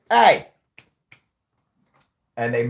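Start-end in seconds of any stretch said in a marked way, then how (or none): 0.1-0.5 sharp, high-pitched loud shout; yell at the clerk. Retold or imitated. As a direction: imitate a senator yelling for attention. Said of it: "he yells at the clerk".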